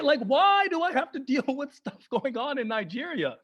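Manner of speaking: normal talking voice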